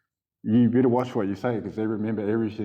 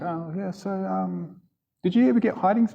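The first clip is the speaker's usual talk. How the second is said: put-on innocent kid asking; 7 semitones higher than usual. Boy asking teacher innocently.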